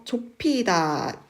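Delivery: 좁히다 slowly as 조피다; merged aspirate. Shown as a demonstration. Teacher showing contraction, slow 좁히다 pronounced 조피다. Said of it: "비읍과 히읗이 축약돼서 피읍이".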